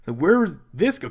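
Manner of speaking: puzzled, rising what; baffled fan asking where this goes; confused surprise. Retold or imitated. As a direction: caught off guard, voice climbing. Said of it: "I was like".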